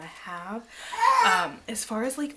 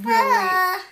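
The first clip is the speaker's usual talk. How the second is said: startled cry; 11 semitones higher, 12 dB louder than usual. Real, not acted. Sudden outburst.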